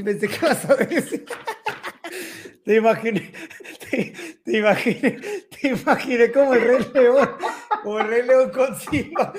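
laughing hard while talking; words breaking into laughter; laughing through pain. Real, not acted. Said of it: "no me hagas reír cuando tengo agua".

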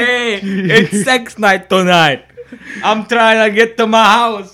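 loud, high, slow shouted put-on voice; mock rushing husband. Friend voices the driver rushing home, loud high mock shout. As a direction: yell it like a cartoon guy late for date night.

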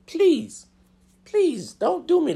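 high squeaky begging; squeaky plea. Acted. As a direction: plead with a partner in a thrown-on squeaky voice. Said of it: "I have to throw on my squeaky voice".

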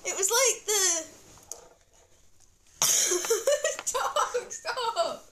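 high, loud words broken up by laughing; real helpless laughter. Real, not acted. Child trying to explain the film, laughing hard. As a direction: try to talk while cracking up.

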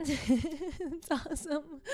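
laughing through the words; giggling praise. Real, not acted.

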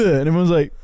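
ordinary talk, nothing marked

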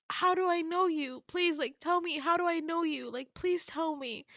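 high-pitched, insistent begging; pleading. Retold or imitated. Dreamer begging a familiar stranger to explain, pleading. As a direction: plead urgently for an answer. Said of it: "begging him".

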